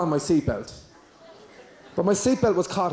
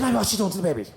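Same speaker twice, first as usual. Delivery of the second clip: mock-outraged son, raised pitch; son going mad; 4 semitones higher, faster than usual. Son seeing breastfeeding in a restaurant, going mad. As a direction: imitate a scandalised son kicking up a fuss. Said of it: "he went mad".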